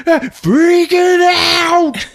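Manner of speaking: high, loud, drawn-out freak-out; hammed-up scream of panic; panicked yell. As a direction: wail it slowly in mock horror. Acted panic over bugs.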